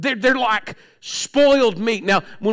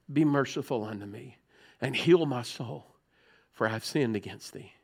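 much softer, lower, pleading prayer; hushed prayer; 10 semitones lower, 10 dB quieter than usual. Real, not acted. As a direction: let it sink to a near-hush. Quiet prayer quote.

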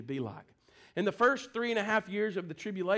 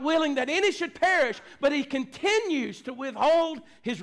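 loud, high, driving sermon delivery; fervent pulpit proclamation; preaching at full force; 7 semitones higher, 4 dB louder than usual. Real, not acted. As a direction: preach it hard, punching the key words.

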